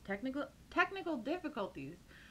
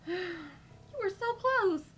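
gloating villain; 7 semitones higher than usual. Acted. Villain gloats at Sarah after Joe dies, high and mocking. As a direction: drag out the cruel gloat.

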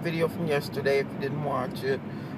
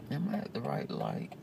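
usual speaking voice

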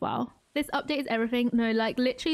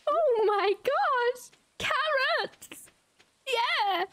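much higher, slow awed squeal; overjoyed; 10 semitones higher, much slower than usual. Real, not acted. Player overjoyed at finding carrots, squealing.

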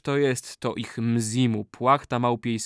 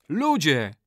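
booming call; 4 semitones higher than usual. Acted. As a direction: summon them with a ringing voice. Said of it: "rzekł donośnym głosem".

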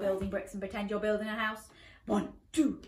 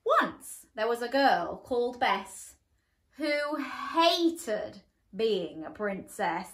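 usual speaking voice

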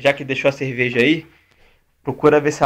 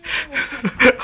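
short high burst of laughter; 11 semitones higher than usual. Real, not acted. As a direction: cackle at the failure.

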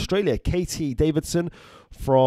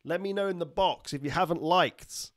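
ordinary delivery

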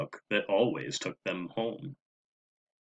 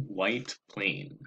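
plain reading voice

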